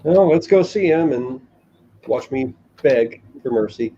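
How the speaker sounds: ordinary talk, nothing marked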